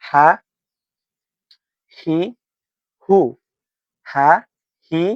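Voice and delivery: হা, হি, হু with a breathy h before each short vowel; ha hi hu drill. Shown as a demonstration. Teacher drilling হা হি হু letter sounds for Quran learners. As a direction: sound হা হি হু, h clear then the vowel. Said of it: "হা দিয়ে উচ্চারণ করি আমরা".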